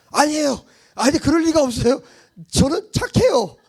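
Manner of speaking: put-on high, loud childish denial; protesting innocence. A sinner whining to God that he is good.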